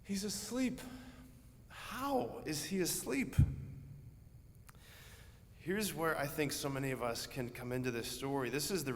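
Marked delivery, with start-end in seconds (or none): 0.0-3.3 high, stunned, can't believe he's sleeping; high incredulous whisper of shock; incredulous disbelief. Acted. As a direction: voice leaps up in disbelief. Disciples shocked Jesus sleeps in storm.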